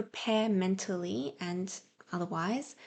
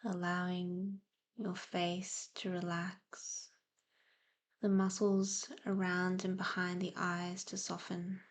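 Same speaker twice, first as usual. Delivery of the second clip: guided meditation; 4 dB quieter than usual. Real, not acted. Host easing listeners into breathing and present awareness, soft meditative delivery. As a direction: gently guide them into calm, leaving space to breathe.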